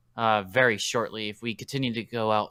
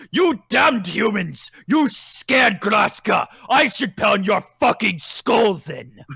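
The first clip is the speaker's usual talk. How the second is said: loud, slow, higher roaring threat; Graska's furious shout; 9 semitones higher, 8 dB louder, slower than usual. Acted. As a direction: bellow like an enraged brute. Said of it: "you can hear Graska go".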